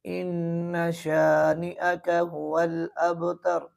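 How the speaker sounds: slow, शानिअका clear and unstretched; slow correct recitation. Shown as a demonstration. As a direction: recite slowly, crisp sh. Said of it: "बल्कि यूँ पढ़ना है".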